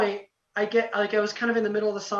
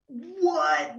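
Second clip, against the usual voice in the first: high, drawn-out astonished question; stunned disbelief; 7 semitones higher than usual. Retold or imitated. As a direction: re-enact a starstruck double take, pitched up. All stunned as star walks out.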